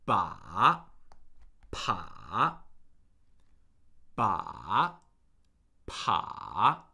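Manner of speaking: "Ba" and "pa" dipping very low, then rising; third tone. Shown as a demonstration. Teacher drilling the third tone, low dip and rise on "Ba, pa". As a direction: drop each "Ba, pa" to the bottom and swing up. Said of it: "we're gonna do the third tone".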